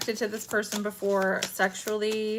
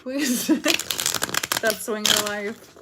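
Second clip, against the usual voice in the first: laughing; 3 dB louder, much slower than usual. Real, not acted. Laughing at swinger joke.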